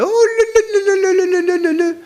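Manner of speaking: high, sing-song gossiping la la la; ladies chattering. Retold or imitated. Women embracing and chattering, high sing-song. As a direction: mimic the women cooing over each other. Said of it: "They're embracing each other".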